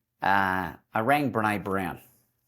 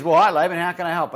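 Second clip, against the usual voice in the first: lightly voiced Les Brown; 6 semitones higher, 5 dB louder, faster than usual. Voicing Les Brown on phone.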